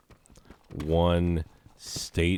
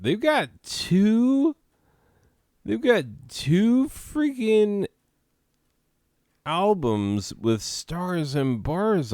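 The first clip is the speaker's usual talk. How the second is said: high, loud disbelief; can't believe it, pitch way up; incredulous; 13 semitones higher, 5 dB louder than usual. Real, not acted. Outraged at album covers.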